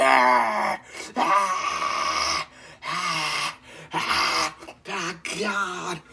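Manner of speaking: fading pained groans. Real, not acted. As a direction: groan as the heat fades. Calming after the burn.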